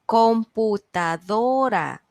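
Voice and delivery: computadora said slowly, stress on do; stressed-syllable demo. Shown as a demonstration. Teacher giving students a word to find the stressed syllable, computadora slowly with stress on do. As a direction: spell out computadora syllable by syllable, lifting do. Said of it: "díganme cuál es la sílaba tónica".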